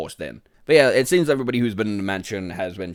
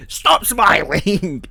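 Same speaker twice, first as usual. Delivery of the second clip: slow, loud, high stop smiling; slow disgusted yell at the monster; drawn-out yell; 8 semitones higher, 5 dB louder, much slower than usual. Real, not acted. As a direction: bellow it at the creepy face. Yelling at creepy game monster.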